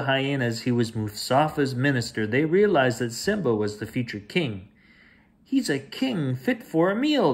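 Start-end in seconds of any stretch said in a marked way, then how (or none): 5.4-7.3 higher, sneering hyena voice; hungry hyena. Acted. A hyena mocks the cubs, high and sneering.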